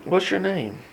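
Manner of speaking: quiet, calm question. Retold or imitated. Jesus calmly asking the possessed man, softly. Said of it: "'cause he's so calm".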